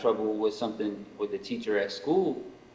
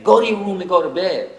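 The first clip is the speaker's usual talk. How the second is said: a parent's order, higher and louder; mock stern parent; 6 semitones higher, 6 dB louder than usual. Imitating parents sending a kid to bed, loud and stern. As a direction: bark the order like a strict parent.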